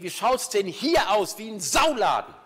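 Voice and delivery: loud, high, slow furious yell; yelling father. Retold or imitated. Tidy father shouting at his kids about the mess. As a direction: bellow at the messy house. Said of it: "hat immer geschrien".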